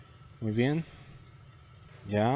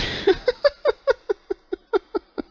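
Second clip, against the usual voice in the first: risa larga y muy aguda; carcajada. Real, not acted. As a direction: suelta una carcajada aguda y entrecortada. Risa de alivio al terminar. (carcajada de alivio al acabar el juego)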